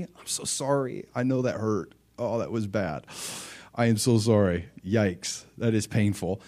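soft, sympathetic comforting. Shown as a demonstration. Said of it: "you show sympathy".

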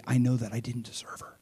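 hushed, breathless admission; whispered confession. Real, not acted. Pastor confessing to his church, whispered.

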